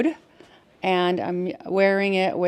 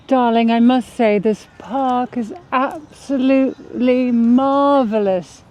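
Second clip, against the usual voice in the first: put-on British accent; 2 semitones higher than usual. Wife raving about the park to her husband, put-on British accent. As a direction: lay on a posh British accent, savouring the words. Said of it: "I am now British".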